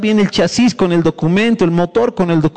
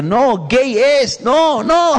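high, stretched-out warning cries; God shouting; 5 semitones higher, much slower than usual. Acted. God warning someone off a bad match, high drawn-out shout. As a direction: cry out the warning, climbing in pitch. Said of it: "Dios te puede estar gritando".

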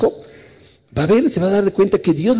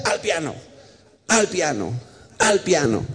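a hint of a mother, softer repeated command; retold mother's order; 2 semitones higher, 5 dB quieter than usual. Mother sending her boy to practise, lightly voiced order. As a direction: repeat it as a short order with pauses between. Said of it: "mi mamá me decía".

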